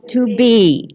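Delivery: loud, high congregational affirmation; call to affirm. Acted. Leading the congregation in a spoken affirmation, loud. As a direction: lead the room in saying it together.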